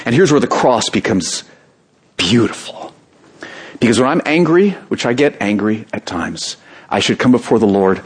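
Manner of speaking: ordinary talk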